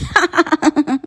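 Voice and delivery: high, giggly witch cackle; high ha-ha-ha of a storybook witch; witch's laugh. Acted. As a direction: cackle like a self-pleased witch. Witch cackles after her own boast.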